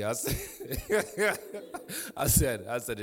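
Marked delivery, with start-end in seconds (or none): 0.9-2.1 a real, rising amused laugh; laughing at son's money comment. Real, not acted.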